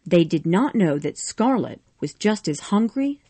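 plain reading voice

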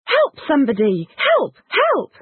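high desperate shout for help; yelling help at the top of your voice; cry for help. Acted. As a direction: scream it like you're in danger. Calling for help, screaming.

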